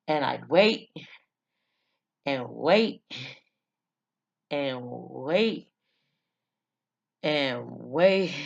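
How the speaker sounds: long pauses between each "wait", dragging it out; "wait" drawn longer and longer, endlessly patient. Retold or imitated. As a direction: stretch each "wait" with a dip and swoop in pitch. Acting out endless childhood waiting.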